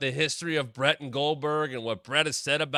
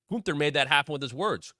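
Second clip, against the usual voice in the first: ordinary delivery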